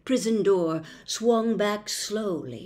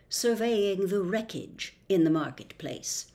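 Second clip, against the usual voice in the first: ordinary delivery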